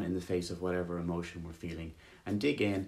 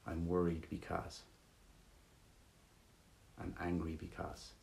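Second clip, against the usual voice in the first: calm meditation guide, softer than the welcome; easy, unforced guiding voice with room to breathe; guided meditation; 6 dB quieter than usual. Real, not acted. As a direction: guide listeners gently back to the breath, leaving long pauses.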